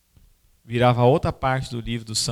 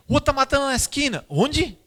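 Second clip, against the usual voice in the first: excited onlookers; 12 semitones higher, 5 dB louder than usual. Retold or imitated. Crowd eager to see blood.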